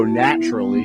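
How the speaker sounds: usual speaking voice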